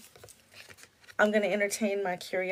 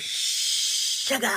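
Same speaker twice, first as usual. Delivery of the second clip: voice imitation; 4 semitones higher than usual. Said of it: "he goes".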